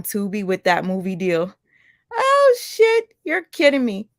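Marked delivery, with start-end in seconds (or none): 2.1-4.1 shocked outburst. Real, not acted. Hearing Brianna's movie deal news.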